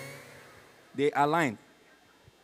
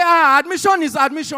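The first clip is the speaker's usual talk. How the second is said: high, loud insistence; jubilant, brushing off the objection; excited mother; 7 semitones higher, 8 dB louder than usual. Acted. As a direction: crow it like a thrilled mum. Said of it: "she was so excited".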